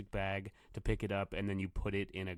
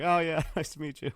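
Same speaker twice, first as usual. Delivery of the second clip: laughing; 7 semitones higher, 6 dB louder than usual. Retold or imitated. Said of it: "And he's like".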